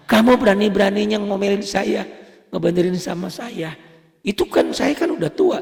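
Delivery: put-on high, loud offended old man; mock indignant elder. Old Palembang elder scolding young teacher, hammed-up indignation. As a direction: huff like an insulted elderly student. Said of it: "elder retold scolding teacher".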